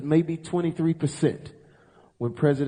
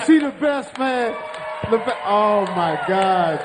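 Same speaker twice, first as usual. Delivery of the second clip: excited shout; 5 semitones higher, 4 dB louder than usual. Real, not acted. Co-host laughing, shouting.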